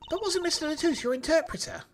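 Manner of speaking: put-on high, chirpy Cockney girl voice; high-pitched Cockney girl. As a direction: squeak it out like a Cockney kid. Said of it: "giving Trucy that high-pitched Cockney voice".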